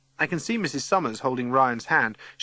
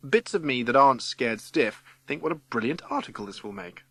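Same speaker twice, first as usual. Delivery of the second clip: ordinary delivery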